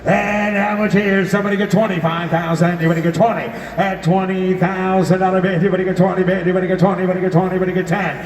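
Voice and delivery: high-pitched rolling auction chant; frantic trading floor shouting. Acted. Auctioneer calling for bids.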